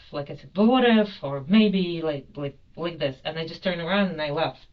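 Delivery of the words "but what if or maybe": very loud, high-pitched outburst; retold anger. Passenger demonstrates the outburst at staff, retold anger. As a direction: re-enact losing control, punching each phrase. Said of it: "it was just total anger".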